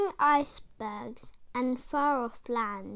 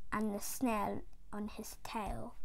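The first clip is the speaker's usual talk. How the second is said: whisper; 4 semitones lower, 12 dB quieter than usual. A narrator's reading. Refrain repeated, whispered.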